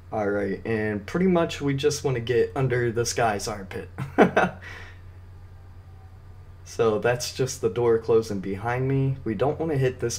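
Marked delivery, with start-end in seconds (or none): 3.9-5.1 short, mostly voiceless chuckle; amused chuckle. Real, not acted. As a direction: chuckle at your own joke. Amused by the armpit remark.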